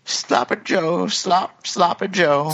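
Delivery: high, slow, goofy put-on voice; horrible impression. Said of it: "That's a horrible impression".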